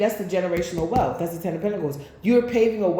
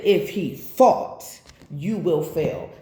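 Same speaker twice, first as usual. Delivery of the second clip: slow solemn warning; 3 semitones lower, slower than usual. Retold or imitated. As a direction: draw every word out slowly, like a prophecy. Quoting the card again, slowly.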